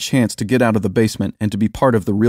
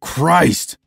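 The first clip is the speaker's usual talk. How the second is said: higher-pitched irritated exclamation; exasperated exclamation; 7 semitones higher than usual. A narrator's reading. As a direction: burst out in annoyance. Sergeant complains about the smell.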